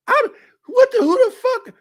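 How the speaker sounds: high, loud shriek imitating her; high, loud mocking cry. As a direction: squeal it in disbelief.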